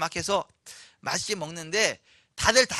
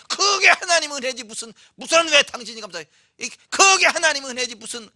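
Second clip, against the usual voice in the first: higher, louder sanctimonious brush-off; mock the holier-than-thou believer; self-righteous scoff; 6 semitones higher, 8 dB louder than usual. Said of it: "본인 혼자만 믿음 좋은 것처럼". (독선적으로 비꼬며 흉내내는 말투)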